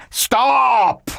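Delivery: high, loud cry; commanding shout. Acted. God stops Abraham's knife, a loud commanding cry. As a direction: halt him with one cry. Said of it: "God said".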